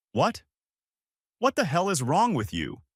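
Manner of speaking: put-on father exploding at his child over the canceled party, angry shout; angry yell. Father yelling on phone.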